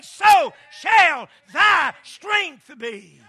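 loud rhythmic sermon cadence; preaching at full force. Acted. Preacher rousing his church about strength, full-force whooping delivery. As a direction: pound out each line to the congregation.

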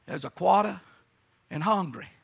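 low, soft South Carolina drawn pronunciation; South Carolina accent. Shown as a demonstration. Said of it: "Some of you folks from South Carolina".

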